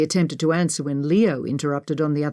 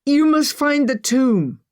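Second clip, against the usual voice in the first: high, loud, slow plea; drawn-out urgent command; urgent cry; 9 semitones higher, 5 dB louder, slower than usual. A narrator's reading. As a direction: cry it out with desperate weight. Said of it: "Ollie cried".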